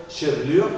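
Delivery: chevelure as "che-vlure", second E dropped; in chevelure sound the first E, skip the second; first mute E kept. Shown as a demonstration. French teacher models chevelure for student, first E sounded, second dropped. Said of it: "c'est la première qu'on articule".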